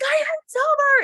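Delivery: shouting over stadium fireworks; excited shout. Retold or imitated. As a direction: yell it over the noise. Repeating news to husband loudly.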